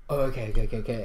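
low, soft imitation of giving in; mumbled-down, chastened okays; subdued compliance. Retold actress giving in when corrected.

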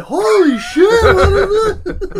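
high, slow, shouted disbelief; mock shocked shout. Imagining Steve at the birth, mock shocked shout. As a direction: yell it out in hammed-up shock. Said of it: "Steve, were you like".

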